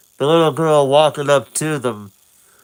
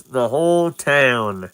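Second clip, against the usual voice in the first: ordinary delivery, nothing marked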